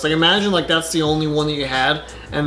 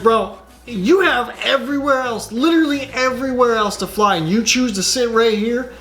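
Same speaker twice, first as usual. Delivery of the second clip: mock exasperation; 6 semitones higher than usual. Scolding the fly out loud.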